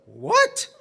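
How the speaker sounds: high, startled question; startled mother. Acted. Mother hearing the rabbi has arrived, startled. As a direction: react with mock alarm.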